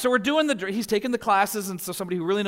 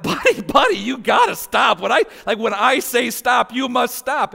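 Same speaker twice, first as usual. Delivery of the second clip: loud, insistent scolding, pitched up; retold yelling; 4 semitones higher, 6 dB louder than usual. Father retelling how he told his learner-driver son to stop, yelling. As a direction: re-enact an exasperated dad laying down the rule. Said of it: "when I'm yelling stop".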